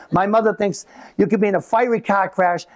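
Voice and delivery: normal talking voice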